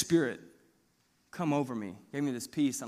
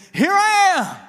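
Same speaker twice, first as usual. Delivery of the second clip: high, loud, ringing proclamation; bold Isaiah; 11 semitones higher, 7 dB louder, slower than usual. Retold or imitated. The preacher voices Isaiah answering God, higher and louder. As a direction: declare it like a prophet answering God. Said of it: "I was not like Isaiah saying".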